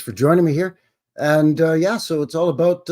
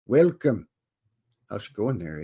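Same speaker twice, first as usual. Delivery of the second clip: ordinary talk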